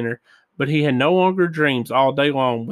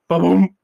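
higher, louder "ba-boom"; imitated crash; 4 semitones higher, 8 dB louder than usual. A narrator's reading. Reader sounds out Bullwinkle's fall, imitated crash.

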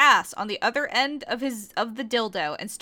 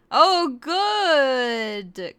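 breathy mock gasp, a bit louder; 3 semitones higher, 5 dB louder than usual. Korra at the climax, mild mock gasp. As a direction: gasp the words out, hammed. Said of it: "Korra gasped".

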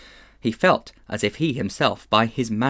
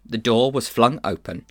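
ordinary delivery

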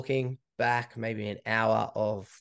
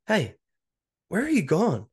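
retold stressed call, pitched up high; upset, stressed; 8 semitones higher, 4 dB louder than usual. Runner phones his missing buddy, upset and stressed. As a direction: call after him in mild distress. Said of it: "started to get really upset and stressed".

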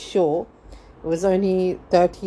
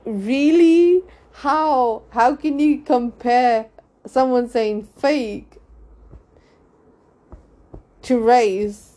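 higher-pitched, drawn-out disbelief; incredulous; 5 semitones higher, slower than usual. Retold or imitated. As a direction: demand an explanation, voice raised in pitch. Retelling her disbelief at Sharon's comparison.

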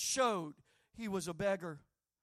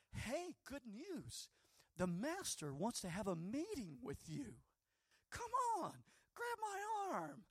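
sucking up; 3 semitones higher, 7 dB quieter than usual. Acted. Crowd fawning over the beggar they silenced, sugary sucking up. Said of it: "now they start sucking up to Jesus".

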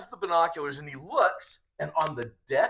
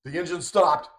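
raised, higher retold alarm; mock freaking out; 4 semitones higher, 4 dB louder than usual. Retelling a man who starts freaking out, mild alarm. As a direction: lift the voice into mild mock panic. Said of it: "he starts freaking out".

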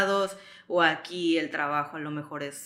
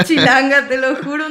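laughing; 6 semitones higher, 10 dB louder than usual. Real, not acted. Joking she feels like a local.

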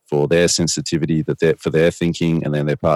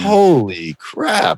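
much higher and very slow, amazed; awed exclamation; 4 dB louder, much slower than usual. Retold or imitated. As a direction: stretch it out in disbelief. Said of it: "aggressive and dramatic when I portray it".

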